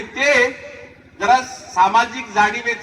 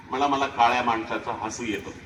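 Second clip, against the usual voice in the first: student's reply; 10 semitones lower, 6 dB quieter than usual. Retold or imitated. Student answers why laughing.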